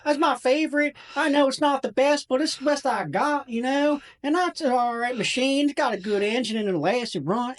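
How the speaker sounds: put-on very high Southern child voice; little tractor kid. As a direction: show off a tractor as a proud eight-year-old. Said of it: "he's, like, this little kid".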